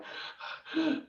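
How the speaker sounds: mimed panting to catch breath; acted breathless gasping. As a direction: gasp as if out of breath. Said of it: "I'm trying to catch my breath".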